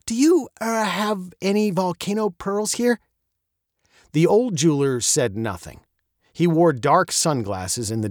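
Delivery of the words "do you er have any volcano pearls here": put-on higher, polite girl's voice. A narrator's reading. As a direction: ask politely, a little hesitant. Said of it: "she asked politely".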